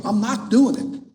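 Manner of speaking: insistent refusal. Retold or imitated. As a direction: dig in, replaying an exasperated no. Repeated refusal to friend, acted.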